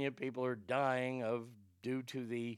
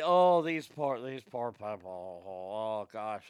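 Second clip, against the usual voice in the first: mock pity; 6 semitones lower, slower than usual. Host mocking pity for the drought victims, drawn-out fake sympathy. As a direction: ham up a sorrowful sigh.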